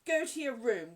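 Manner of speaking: loud, angry order; breathless cry. A narrator's reading. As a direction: send the child away in a fury. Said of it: "cried Miss Minchin breathlessly".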